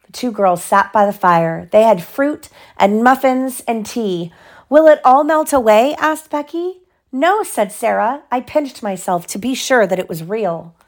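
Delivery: animated storybook reading; lively bedtime-story voice; story narration. A narrator's reading. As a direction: read aloud to a child, lifting the characters' lines. Reads story to child.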